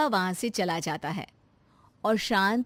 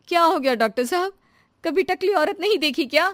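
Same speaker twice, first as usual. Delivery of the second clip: higher, louder, fast snappy challenge; indignant retort; 7 semitones higher, 5 dB louder, faster than usual. Acted. Shanti challenging the doctor, fast indignant retort. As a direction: snap back at the staring doctor.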